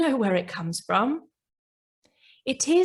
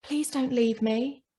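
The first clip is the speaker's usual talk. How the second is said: pleading scared ego; 5 semitones higher than usual. Acted. Scared ego begging her not to leave, mild plea. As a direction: beg gently like a frightened part. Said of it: "It's saying".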